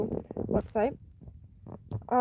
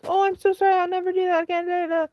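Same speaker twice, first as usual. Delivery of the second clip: mock sorry boyfriend; 7 semitones higher, faster than usual. Mocking boyfriend's fake apology.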